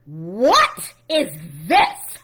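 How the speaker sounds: very slow, building mock horror; hammed-up shock. Retelling a sister reading the court papers, hammed-up shock. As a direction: draw out each word, swelling to a loud finish.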